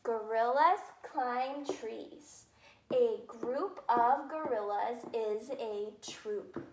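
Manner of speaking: very slow, word by word; slow reading aloud. Shown as a demonstration. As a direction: read each word out deliberately for children. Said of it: "I have two sentences".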